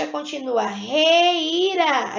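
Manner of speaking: reira drawn out slowly as a separate piece; slow syllable demo. Shown as a demonstration. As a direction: stretch out reira as the rest of the word. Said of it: "Se eu parar aqui no par".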